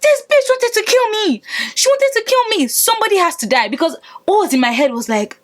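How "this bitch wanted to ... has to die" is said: much higher, frantic yell; retold frantic scream. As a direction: shriek it out in a rage. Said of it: "'cause I was screaming".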